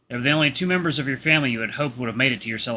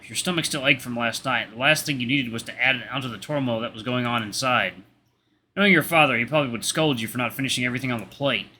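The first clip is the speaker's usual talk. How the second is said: ordinary delivery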